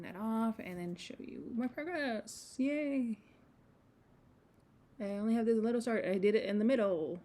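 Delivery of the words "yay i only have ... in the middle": excited. Real, not acted. Showing new start excitedly.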